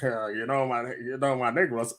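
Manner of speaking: low, mocking tycoon voice; Texas oil tycoon. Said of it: "Texas oil tycoon with they big hats talking crazy".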